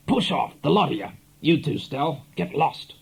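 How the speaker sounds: a sudden savage turn on his followers; savage dismissal. Acted. Jono turning on his gang of followers, savage. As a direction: cut the grins off their faces. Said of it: "with a savage".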